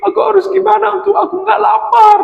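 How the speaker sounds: very high, loud wailing, mock sobbing; wailing cry. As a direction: wail like a starving little one. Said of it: "dia sambil menangis". (Tutu menangis kelaparan, meratap keras)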